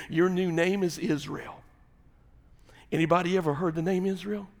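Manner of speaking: ordinary delivery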